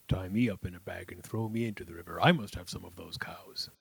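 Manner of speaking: low, fast whispering brother. A narrator's reading.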